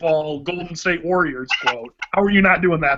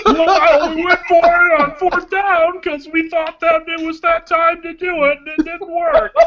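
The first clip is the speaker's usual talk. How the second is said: put-on much higher, whiny little kid; 10 semitones higher, 3 dB louder than usual. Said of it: "my really shitty Brady Hoke impression".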